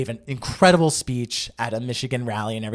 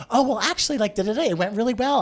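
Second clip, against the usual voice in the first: upbeat and optimistic; 8 semitones higher, faster than usual. Retold or imitated. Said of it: "I went in with optimism".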